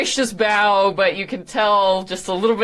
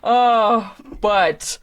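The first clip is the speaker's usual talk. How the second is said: ordinary talk, nothing marked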